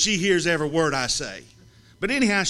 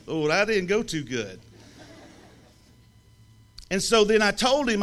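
usual speaking voice

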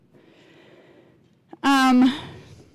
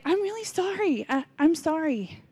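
softer, meek imitated apology; Marty; 4 semitones higher, 6 dB quieter than usual. Husband apologizing to his frustrated wife, imitated meekly. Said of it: "And he's like".

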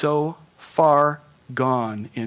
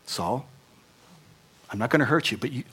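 gentle voice of God. Pretend. God gently calling the fallen Saul, tender. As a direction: tenderly soothe the fallen man. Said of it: "just kinda gently went".

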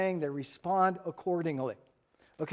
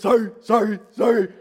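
loud, barked-out apology; apologizing dog; 5 semitones higher, 11 dB louder than usual. Acted. Dog answers the yelling owner, barked mock apology. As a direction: bark each word like a dog. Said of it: "we think the dog's gonna say".

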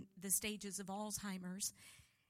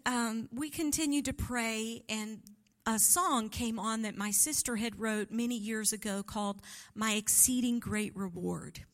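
normal talking voice

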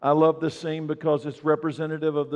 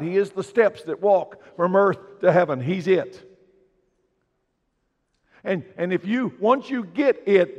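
louder, rising preacher's proclamation; full-force preaching; 5 semitones higher, 5 dB louder than usual. Acted. Pastor proclaiming Jesus to the church, full-force preaching. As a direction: drive it home to the congregation.

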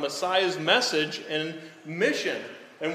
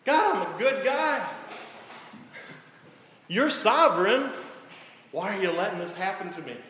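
complaining to God; 3 semitones higher than usual. Retold or imitated. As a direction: whine at God like a wronged good guy. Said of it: "Have you ever thought that?".

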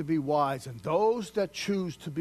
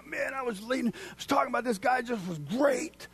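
pumped-up; 9 semitones higher than usual. Retold or imitated. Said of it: "you're so pumped".

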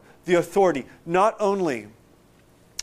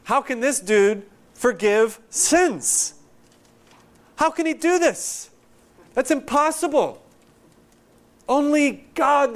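high-pitched imitated disbelief, climbing as it goes; incredulous scribes; 7 semitones higher, slower than usual. Preacher voicing scribes questioning Jesus, mock incredulity. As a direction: protest as if it simply cannot be done. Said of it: "The scribes have a pretty legit question".

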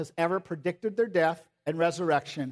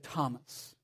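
tender Jesus; 4 semitones higher than usual. Retold or imitated. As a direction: gently coax a doubting friend.

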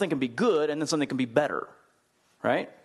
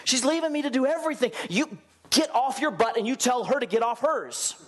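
exasperated complaint; 10 semitones higher than usual. Retold or imitated. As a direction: voice Martha fed up with her sister. Martha complains to Jesus.

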